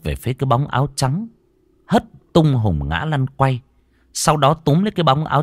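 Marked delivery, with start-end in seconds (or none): none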